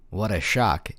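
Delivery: usual speaking voice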